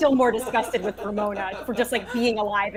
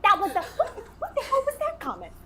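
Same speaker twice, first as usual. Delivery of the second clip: acted indignant disbelief; 7 semitones higher than usual.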